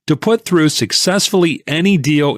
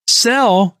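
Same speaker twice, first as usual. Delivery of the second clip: high pitched anguished cry of sell; a pained high shout of disbelief; yelled; 10 semitones higher than usual. A narrator's reading. Tipster cries out as deacon sells sugar, anguished high yell. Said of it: "yelled the tipster".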